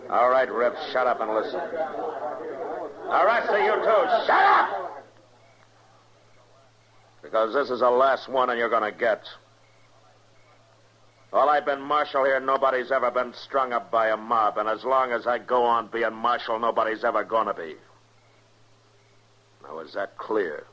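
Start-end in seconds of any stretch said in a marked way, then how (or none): 0.1-5.0 sharp order rising to a roaring shut up; commanding shout. Acted. Marshal silences the shouting mob. 7.7-17.7 firm raised voice. Acted. Marshal lays down the law.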